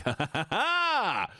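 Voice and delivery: high, slow taunting cackle voice; mocking computer voice. Streamer voicing her computer mocking her. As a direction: sneer it out like a gloating machine.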